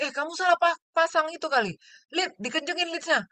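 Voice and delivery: high, urgent, rattled instructions; alarmed orders to nurse. Retold or imitated. Doctor urgently tells nurse the ECG leads are wrong, high and alarmed. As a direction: voice jumps up in panic.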